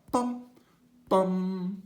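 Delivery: Bum, bum sung high and punched; accented notes. Shown as a demonstration. Teacher shows the staccato accents, sung high and punched. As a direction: make each Bum spiky and stand out. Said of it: "you make it stand out".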